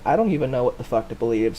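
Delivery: ordinary talk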